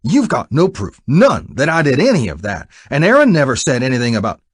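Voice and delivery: loud, high, furious threat; angry. Acted. Buck shouts at Jack to stop talking, furious. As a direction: shout him down.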